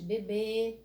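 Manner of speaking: bebê with a closed final ê; closed vowel. Shown as a demonstration. Teacher giving circumflex example, closed vowel. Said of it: "me dá um som fechado".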